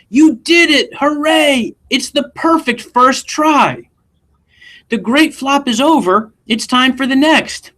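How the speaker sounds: high, loud joyful cheer; overjoyed aunt. Acted. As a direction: shout it like a thrilled old aunt. Aunt's joyful cry in story.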